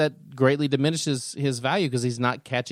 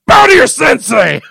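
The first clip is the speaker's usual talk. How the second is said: high, loud shouted order to bow; sharp martial arts commands; 12 semitones higher, 15 dB louder than usual. Pretend. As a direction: bellow it like a dojo master. Gloating shout at co-host.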